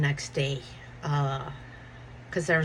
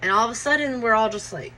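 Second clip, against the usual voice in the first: ordinary delivery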